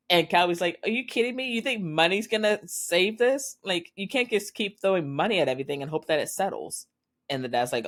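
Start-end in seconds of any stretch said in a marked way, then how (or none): 0.8-6.9 incredulous outrage. Acted. Son confronting his father.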